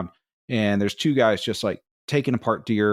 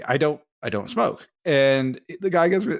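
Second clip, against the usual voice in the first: ordinary talk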